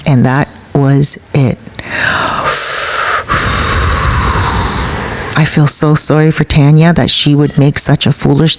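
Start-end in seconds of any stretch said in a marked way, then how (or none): 1.6-5.3 long, unvoiced exhale; big exhaled sigh; long heavy sigh. Real, not acted. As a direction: let out a drawn-out sigh. Sigh closing the confrontation story.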